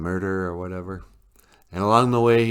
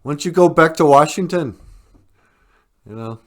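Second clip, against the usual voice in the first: loud, high, hammed-up furious yell; mock angry shout; 7 semitones higher, 9 dB louder than usual. Val Kilmer yells at FBI in mock anger. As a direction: shout him off the land in mock rage. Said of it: "he's just mad, he's shaking".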